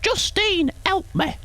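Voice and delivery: shrill, high pleading call; mock cry for help. Streamer playing a damsel calling Justine for help.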